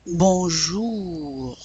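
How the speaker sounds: long French "Bonjour", falling into a breathy R; dry final R. Shown as a demonstration. Teacher shows OU and dry R, long "Bonjour". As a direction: stretch the oo of "Bonjour", R barely there. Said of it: "It's a dry R at the end".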